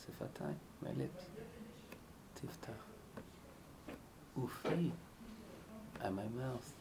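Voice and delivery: soft, intimate pleading prayer; hushed heartfelt prayer. Shown as a demonstration. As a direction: murmur it as if asking for help. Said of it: "Speak with feeling".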